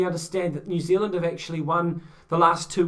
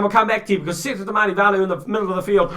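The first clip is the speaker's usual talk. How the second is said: fast, rapid-fire race call; racecourse commentator; 3 semitones higher, 5 dB louder, much faster than usual. Real, not acted. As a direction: rattle it off like a horse race caller. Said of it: "I sound like a racecourse commentator right now".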